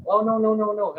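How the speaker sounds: lightly voiced eager cafe lady; cafe lady protesting. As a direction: mimic her quick refusal. Cafe lady refusing money.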